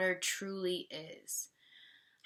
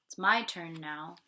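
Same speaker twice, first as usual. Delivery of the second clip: usual speaking voice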